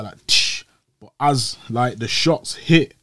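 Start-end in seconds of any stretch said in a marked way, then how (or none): 0.3-0.6 whispered tsh of breaking glass; shattering glass noise. Retold or imitated. Imitating the van windows breaking, whispered. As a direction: hiss the glass smashing. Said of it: "windows were shut".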